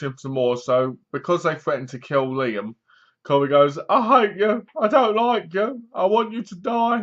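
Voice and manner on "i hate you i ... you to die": high-pitched retold rejection; mock outburst. Father forced to disown his son, mock outburst. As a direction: rattle off mock hatred in a raised pitch.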